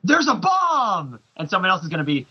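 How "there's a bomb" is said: high, drawn-out stage yell; mock yell. Action hero yelling from a Broadway stage, mock yell. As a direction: yell it slowly to a whole theater. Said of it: "that he's gonna yell".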